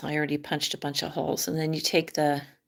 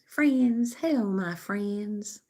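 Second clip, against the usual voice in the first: higher, slow mock hello; imitated YouTuber greeting; 4 semitones higher, much slower than usual. Michigan vlogger mocking others' greeting, high and drawn out. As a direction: draw it out, mimicking those YouTubers. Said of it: "We don't say".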